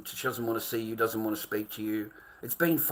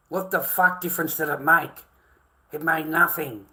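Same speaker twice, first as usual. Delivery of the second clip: angry; 4 semitones higher, 6 dB louder than usual. Real, not acted. Angry rant about the court.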